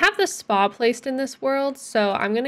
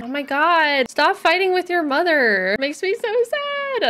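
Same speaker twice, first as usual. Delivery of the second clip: whiny plea; 7 semitones higher than usual. Real, not acted. Scolding fighting Sims.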